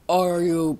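sudden loud, drawn-out gasping reaction; startled gasp. Real, not acted. Player startled mid-mining, loud gasping surprise. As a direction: gasp and burst out loudly.

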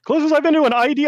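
high fast laughing repeat; high amused echo. Real, not acted. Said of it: "answer repeating question".

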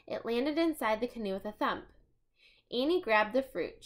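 ordinary delivery, nothing marked

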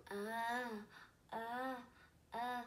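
"Uh" as the short U of umbrella; short U sound. Shown as a demonstration. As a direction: sound out "Uh, uh, uh" as separate vowels.

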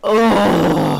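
loud, high drawn-out oh; exasperated burst of groaning; annoyed groan. Real, not acted. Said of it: "I'm so annoyed".

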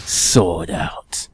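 low whispered boast; mock whisper. Gamer whispering about his giant sword. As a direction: breathe it like a secret.

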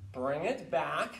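normal talking voice

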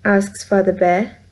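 usual speaking voice

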